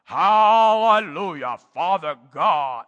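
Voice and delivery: slow, drawn-out, hammed-up hallelujah; slow mocking church-voice praise; mock showy worship. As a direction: lampoon a showy religious prayer, stretching each word. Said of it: "and it wasn't some".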